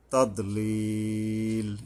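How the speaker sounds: "তাদলিল" with the final i held very long; slow, sustained "তাদলিল"; long madd. Shown as a demonstration. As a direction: chant "তাদলিল", stretching "লিল" for three beats. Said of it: "তিন আলিফ টানতে হবে".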